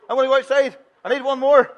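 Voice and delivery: put-on high, loud whiny child voice; child whine. Shown as a demonstration. Minister repeats the whiny line, laughing, high and loud. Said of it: "Need a couple more".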